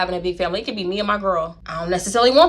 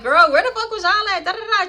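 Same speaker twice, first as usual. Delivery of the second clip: mock exasperated friend; 11 semitones higher than usual. Confronting friend, easing it up.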